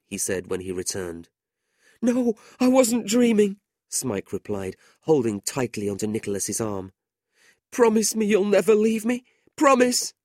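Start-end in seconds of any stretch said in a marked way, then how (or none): 2.0-3.6 much higher, louder, panicked denial; scared boy clinging on; frightened insistence. A narrator's reading. 7.7-9.3 much higher and louder desperate plea; begging not to be left; clinging, panicked plea; frightened pleading. A narrator's reading. 9.6-10.1 a higher, louder frantic "Promise!"; crying out in panic; begging at the top of his voice; desperate cry. A narrator's reading. Boy clinging, begging.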